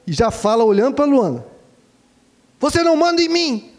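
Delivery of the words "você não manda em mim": put-on high, sharp child's retort; imitating a daughter snapping back in anger; angry retort. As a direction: fire it back like a defiant child. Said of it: "com aquela raiva".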